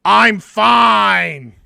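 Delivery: irritated, mocking brush-off; sarcastic, pissed off. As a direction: mimic someone snapping sarcastically that they're okay. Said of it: "in the most sarcastic, pissed off voice".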